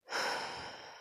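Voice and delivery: short breathy sigh; brief audible exhale. Real, not acted. Sighs between remarks.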